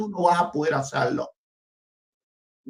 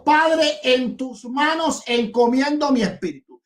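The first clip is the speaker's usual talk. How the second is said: raised, higher quoting voice; retold loud cry; 6 semitones higher than usual. Debater quoting Jesus on the cross to his opponent, raised crying-out voice. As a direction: proclaim the quoted cry with a lifted voice. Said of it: "Jesús dijo a gran voz".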